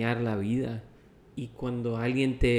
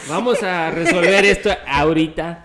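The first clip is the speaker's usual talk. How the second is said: laughing; 7 semitones higher, 13 dB louder than usual. Real, not acted. Laughing about the eaten taco.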